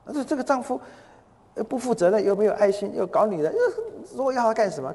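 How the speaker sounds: high-pitched litany of grievances; exasperated complaint. Retold or imitated. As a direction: act out a wife venting about her husband. Wife complains tearfully to pastor's wife.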